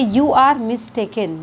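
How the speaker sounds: slow teaching demo. Shown as a demonstration. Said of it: "तो English में कहेंगे".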